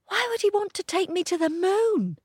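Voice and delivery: high, eager puppy voice; little dog voice. A narrator's reading. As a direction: squeak it like a small dog. Pip voiced.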